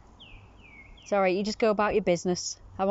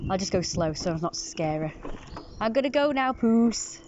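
normal talking voice

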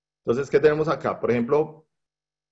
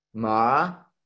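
MA stretched and gliding upward; second tone, a long rising MA. Shown as a demonstration. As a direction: let MA climb like a question. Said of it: "El segundo tono es ascendente".

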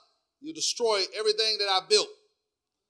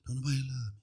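low, soft acted brooding; brooding self-talk; 8 semitones lower, 10 dB quieter, faster than usual. Person nursing old hurts alone, low soft brooding. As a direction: sink into a self-pitying murmur. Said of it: "you meditate on it".

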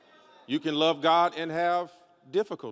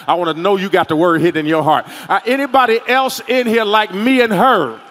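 loud, rapid-fire preaching to one listener; forceful preaching; 3 semitones higher, 11 dB louder than usual. Real, not acted. Preacher singles out one lady before the congregation, forceful preaching. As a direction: press it out loud, barely stopping.

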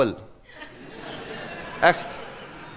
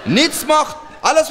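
exasperated shout; 10 semitones higher, 3 dB louder, slower than usual. Acted. Ranting about wife.

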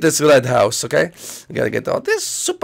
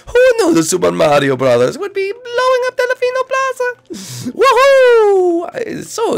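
Mario voice; 3 dB louder than usual. Acted. Mario voice held.

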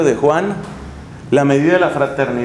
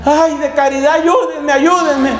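put-on much higher, pleading cry of a drowning old woman; old woman crying for help; 9 semitones higher than usual. Preacher mimics an old nun crying for help, high mock wail. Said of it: "la viejita por allá grita".

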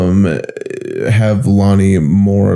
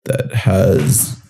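sultry narrator; much slower than usual. Acted. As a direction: drawl it out like a seductive narrator. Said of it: "Just add a little sultry voice to that".

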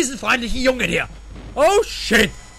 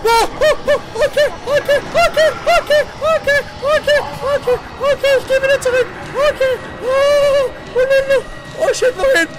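panicked; 11 semitones higher, 5 dB louder than usual. Real, not acted. Fleeing in panic from enemy.

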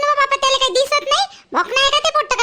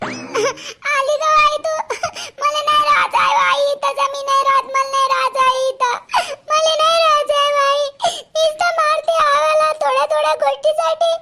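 tearful wailing; 3 semitones higher than usual. Acted. Beaten daughter begs her mother to take her away, wailing. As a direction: wail it through sobs on a held pitch.

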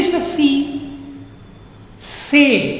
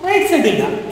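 ordinary talk

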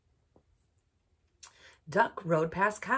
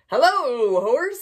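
cheerful duck greeting; 5 semitones higher, 13 dB louder, slower than usual. Acted. Duck greets horse in story.

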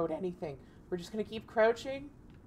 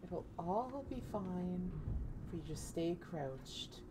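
calm yourself down quietly; hushed reassurance; 9 dB quieter than usual. Real, not acted. Hiding, whispered self-reassurance.

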